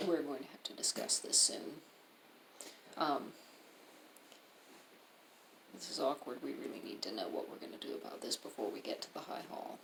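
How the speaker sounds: hushed, sinking toward a whisper; low voice. Acted. Allie urging a companion to discuss it soon, low hushed voice. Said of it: "Allie says in a low voice".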